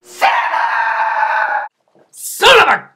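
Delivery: loud, high, fed-up yell; exasperated yell. Real, not acted. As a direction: shout at him in frustration. Yelling at character on screen.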